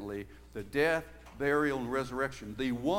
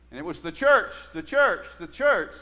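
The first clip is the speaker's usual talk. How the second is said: CHURCH punched much louder each time; stress on CHURCH; 9 dB louder than usual. Shown as a demonstration. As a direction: repeat "the church", hitting CHURCH hard every time. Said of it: "That was the big emphasis".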